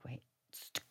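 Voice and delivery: whispered urgent plea to stop; hushed whisper. Retold or imitated. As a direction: hush them, barely voiced. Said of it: "I was like".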